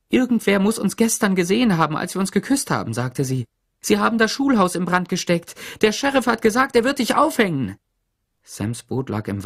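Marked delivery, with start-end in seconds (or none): 0.1-2.9 high-pitched, hurried explanation; urgent alarm. A narrator's reading. Teacher warning Sam they were seen kissing, alarmed. 3.8-7.8 high, loud frantic warning; urgent alarm. A narrator's reading. Teacher telling Sam he will be hanged, frantic.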